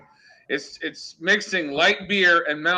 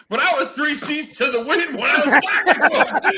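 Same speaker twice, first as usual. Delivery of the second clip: mock quote; 9 semitones higher than usual. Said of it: "the Drunken Biker goes".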